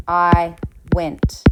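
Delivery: usual speaking voice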